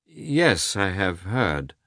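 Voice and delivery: slowing, grudging admission; reluctant. A narrator's reading. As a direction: admit it reluctantly, dragging the end. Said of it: "replied Koznishev reluctantly".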